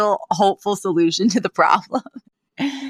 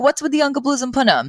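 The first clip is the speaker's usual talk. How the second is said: imitating Yetta; 8 semitones higher, faster than usual. Voicing Yetta to Maggie.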